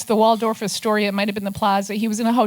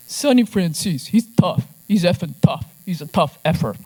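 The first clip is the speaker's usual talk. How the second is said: mock Brooklyn mobster accent, a touch louder; 4 semitones lower, 4 dB louder than usual. Gotti praising Sonny on a wiretap, imitated Brooklyn accent. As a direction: play the Gotti wiretap as a Brooklyn tough guy, not quite convincing. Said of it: "I don't know where I got my Brooklyn accent".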